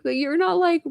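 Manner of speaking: high tearful protest; retold crying plea. Retelling crying to her mom, high tearful. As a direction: pitch it high like a sob. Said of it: "but I was like".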